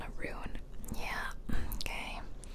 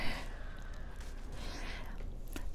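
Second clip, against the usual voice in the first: long, soft breathy laugh; whispered laugh; 7 dB quieter than usual. Real, not acted. Gamer mocking own recorded play, whispered laugh. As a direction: giggle almost silently.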